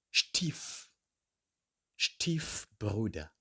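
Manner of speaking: Stiefbruder drawn out slowly, the Stief- part first; careful pronunciation. Shown as a demonstration. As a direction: model Stiefbruder slowly for the class. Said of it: "teacher models the word".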